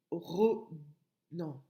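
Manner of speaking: normal talking voice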